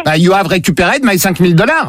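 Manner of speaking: put-on forceful franglais with French accent; badly faked English accent; mock franglais. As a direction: repeat it as if translating, in fake English accent. Same line redone in franglais.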